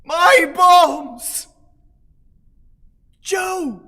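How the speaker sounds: high, loud lament; anguished call. Acted. Master calling his two servants, high anguished cries. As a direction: cry out to them in anguish.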